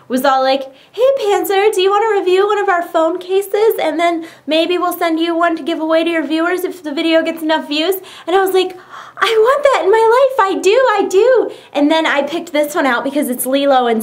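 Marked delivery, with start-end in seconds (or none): 0.9-8.0 higher, chirpy imitation of a company rep; imitating company pitch. Company offering a phone case to review, high put-on pitch. 9.2-11.5 high, gushing re-enacted yes; eager excitement. Replying to the company's offer, acted-out high eagerness.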